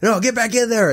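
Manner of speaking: high, urgent mock call; hammed-up crew yelling after him. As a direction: call him back onto set. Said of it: "they're trying to".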